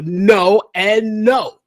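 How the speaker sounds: each no drawn out and firm; slow, deliberate double no; slow emphatic no. Real, not acted. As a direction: spell out no and no, very slowly. Emphatic refusal.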